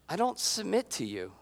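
soft, restrained refusal; quiet firmness. Acted. Jesus refusing his mother's demand, quiet and firm. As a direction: state it firmly under your breath. Bold statement voiced softly as Jesus.

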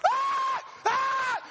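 very high, loud screams; hammed-up panic shriek; freaked-out scream. Preacher retelling warning the rider, freaked-out screaming. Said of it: "I'm freaking out".

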